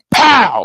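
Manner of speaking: high, sudden "pow" of a blow; punch sound. Retold or imitated. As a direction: imitate a punch landing with a high burst. Imitating the punch landing.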